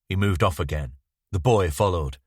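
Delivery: usual speaking voice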